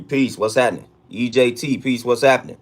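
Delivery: ordinary talk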